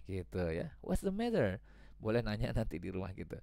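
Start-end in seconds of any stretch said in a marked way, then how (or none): 0.8-1.6 put-on concerned child. Child asks father.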